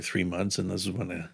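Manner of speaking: ordinary talk, nothing marked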